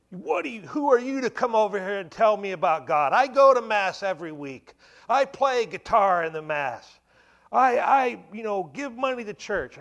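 high, loud outraged yelling; furious dad. Retold or imitated. Father shouting at his preaching son, furious yell. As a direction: blow up at your son as the angry father. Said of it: "and he was mad".

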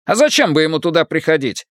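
quick puzzled Irish brogue; Irish accent. Acted. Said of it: "Ирландский акцент работал на всю катушку".

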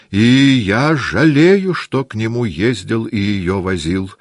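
high, loud, drawn-out start, then easing; emphatic lament, stretching the first words; regretful old count. A narrator's reading. Old count sorry he took her there, emphatically regretful.

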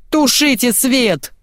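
high, drawn-out director's wail; howled exasperation. A narrator's reading. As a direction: stretch it into a despairing howl. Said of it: "взвыл режиссёр".

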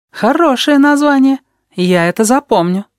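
put-on high, cheeky little boy voice; sly high kid, teasing; odd kid voice. A narrator's reading. Вонючка speaking.

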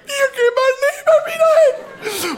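very high, loud outraged mother; mother shriek. Retold or imitated. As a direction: shriek like an appalled mum. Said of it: "Und die Mutter wieder". (kreischende Mutter)

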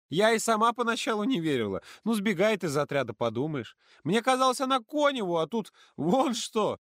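put-on high, loud girl's disbelief; hiccuping with laughter. A narrator's reading. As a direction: hiccup through leftover laughter. Ksyusha girl voice. (Ксюша икает от смеха)

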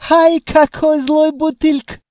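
put-on high, loud, slow accented yelp; indignant djinn. A narrator's reading. Djinn thrown out by the treasury, indignant. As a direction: wail it loudly in a high djinn voice. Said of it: "возмутился синекожий".

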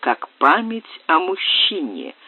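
plain reading voice

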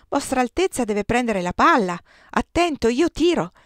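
loud call rising into a warning; shouting. A narrator's reading. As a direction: call out across the game, pitch climbing. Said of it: "gridò il giovane Brahe". (gridando un avvertimento)

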